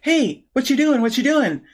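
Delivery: light, high mock call for attention, hey hey; nagging voice. Someone butting in on a focused person, lightly voiced nag. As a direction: pester someone with a sing-song hey. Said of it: "this voice saying".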